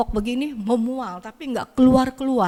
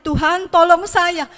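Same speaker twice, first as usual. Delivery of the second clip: higher, louder plea for help; screaming prayer; 7 semitones higher, 6 dB louder than usual. Retold or imitated. Alone in the back she screams to God for help. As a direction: cry out desperately to God. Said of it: "Saya jerit-jerit sendirian".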